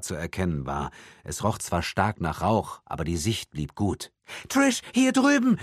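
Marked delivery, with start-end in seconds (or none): 4.5-5.6 very high, loud yell across a crowd; mother's desperate high-pitched shout; screaming. A narrator's reading. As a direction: scream to be found by your child. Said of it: "schrie Michelle".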